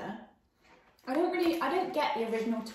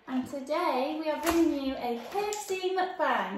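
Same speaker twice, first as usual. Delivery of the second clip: excited announcement; 5 semitones higher, 7 dB louder, slower than usual. Real, not acted. Announcing video topic.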